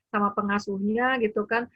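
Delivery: ordinary delivery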